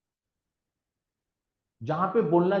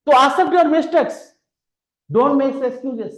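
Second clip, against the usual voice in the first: higher and louder, slowly hammered; emphatic command; 8 semitones higher, 7 dB louder, much slower than usual. Acted. Speaker orders listeners to own their mistakes, loud and slow. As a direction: bark the rule like a coach.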